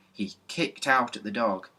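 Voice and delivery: ordinary delivery, nothing marked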